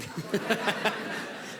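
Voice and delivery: a breathy, airy little laugh; soft laugh. Real, not acted. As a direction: let a short laugh escape after the joke. Laughs at her own traffic joke.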